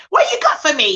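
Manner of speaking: put-on impatient child. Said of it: "Dox is just impatient".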